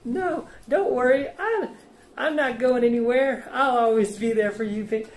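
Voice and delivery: mock a clingy boyfriend begging her to stay; mock simp voice. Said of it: "the beta simp who was like".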